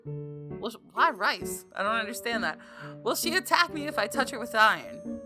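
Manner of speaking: normal talking voice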